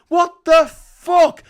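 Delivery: outraged shout. Real, not acted. Ranting at Musk's Mars plan, a loud slow outraged shout. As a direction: blast it out in disbelief.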